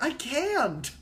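high, loud mock protest; pained refusal. [name] turns down Adrian's plea, mock anguished.

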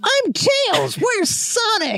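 very high, slow cartoon voice; Tails voice. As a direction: squeak it like a cartoon fox. Voicing Tails.

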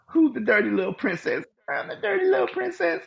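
hammed-up high falsetto princess; high mock princess voice. Acted bedroom dirty talk.

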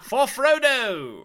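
high, loud, long-drawn battle cry; hammed-up royal rallying yell; George the Fifth. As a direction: cry it out slowly like a king on old radio. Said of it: "George the Fifth voice".

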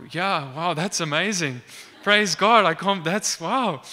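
amazed reaction. Retold or imitated. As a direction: act out being blown away by the news. Acted amazement at the news.

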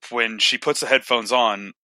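usual speaking voice

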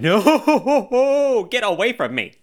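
high, loud, drawn-out comic "no"; hammed-up panicked recoil, half laughing; mock horrified wail. Host retelling his hot tub fungus scare, mock horror. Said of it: "and I went".

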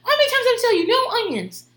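high, loud exasperated chant; flipping out. Retold or imitated. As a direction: imitate a table-pounding meltdown. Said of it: "hitting the table going".

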